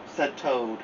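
usual speaking voice

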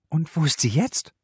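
high agitated question; overexcited voice. A narrator's reading. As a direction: let the voice jump high with agitation. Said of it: "Ihre Stimme schnappte vor Aufregung über".

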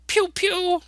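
squeaky cartoon pew-pew; high laser zaps. Teacher voices the comic's laser sound effect high and loud. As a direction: fire toy lasers in a high voice.

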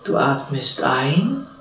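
calm, unhurried meditation voice with long pauses; guided meditation. Real, not acted. (ruhige Stimme einer geführten Meditation, mit langen Pausen)

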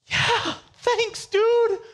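squeaky, very high mock thanks; overexcited falsetto gratitude; a hyped friend gushing over a dirty pen; hyped person. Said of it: "it's the most disgusting".

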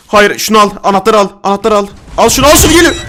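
loud, high, repeated panicked orders; frantic urging. Real, not acted. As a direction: yell short desperate commands. Grabbing key as enemy comes.

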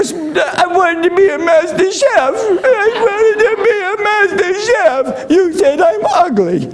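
high wailing sobbed plea, mocking the crying cook; mock crying contestant. MasterChef contestant wailing at Gordon Ramsay, hammed-up sobbing. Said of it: "Tears are coming out of their eyes".

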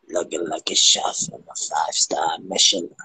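Gollum voice. Retold or imitated. Replaying her line to the fan as a whispered Gollum. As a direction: whisper it like Gollum hissing at his treasure. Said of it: "I sound like fucking Gollum".

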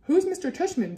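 put-on high, groggy child just woken; lightly voiced sleepy question. A narrator's reading. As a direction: ask as if half awake. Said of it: "Via said groggily".

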